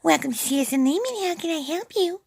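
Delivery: very high, sweet receptionist voice; imitating Wanda's voice. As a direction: chirp it like a tiny sweet receptionist answering the phone. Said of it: "And she had the voice".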